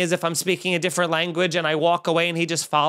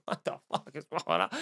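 much softer and lower, almost voiceless; acted muttered disbelief; 4 semitones lower, 9 dB quieter than usual. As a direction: mutter it under your breath, half lost. Acts out himself walking to the car.

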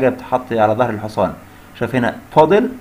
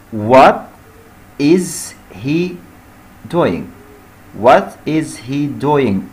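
each word drawn out slowly; slow dictation; much slower than usual. Shown as a demonstration. Teacher dictating the question slowly to students. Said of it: "آي إن جي عشان في is".

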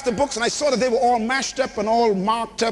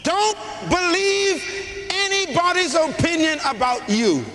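higher, slow, shouted proclamation; preaching at full force; 5 semitones higher, slower than usual. Acted. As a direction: build the crowd to a roar. Preacher building to climax.

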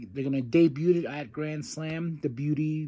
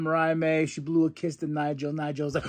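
ordinary talk

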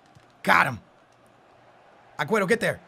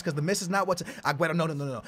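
ordinary delivery

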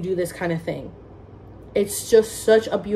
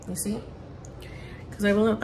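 normal talking voice

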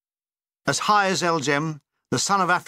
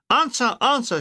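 shouting; 6 semitones higher than usual. A narrator's reading. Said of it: "shouted Edgington".

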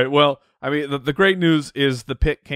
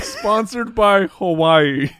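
drawn-out, higher-pitched slogan read; mock ad tagline; 6 semitones higher, 5 dB louder, much slower than usual. Podcaster joking up a Hawaii sponsorship, mock ad tagline. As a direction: stretch it out like a sponsor tag.

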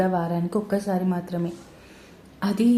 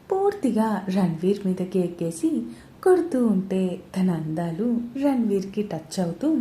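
usual speaking voice

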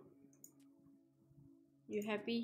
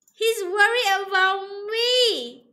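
slow, high, loud squeal of delight; delighted gushing; 7 semitones higher, 11 dB louder than usual. Real, not acted. Delighted that character worries.

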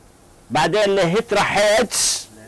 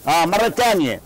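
usual speaking voice

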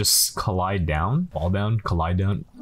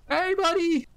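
much higher, mock friendly coo; sweet talk. Window cleaner to the kid inside, high mock coo. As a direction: coo sweetly at the boy behind glass.

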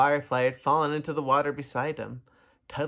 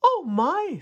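high, loud gasp of delight; a mother frog amazed; surprised frog; 14 semitones higher, 6 dB louder than usual. Acted. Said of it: "said the frog".

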